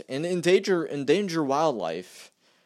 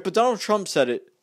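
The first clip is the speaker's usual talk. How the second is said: ordinary talk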